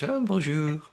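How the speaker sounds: put-on a quick, light French accent greeting; mock French. A host imagining greeting his friend in French, with a mock French accent. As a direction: toss off a hammed-up French accent hello. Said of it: "trying to converse with you in French".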